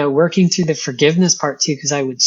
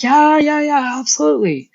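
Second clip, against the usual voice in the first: eager dad; 11 semitones higher than usual. Retold or imitated. Dad eager to change her oil.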